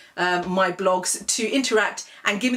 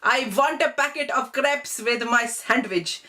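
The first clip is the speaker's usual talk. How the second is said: put-on Desi accent; 2 semitones higher, slower than usual. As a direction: imitate a parent asking slowly for "crepes". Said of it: "they will call them crepes".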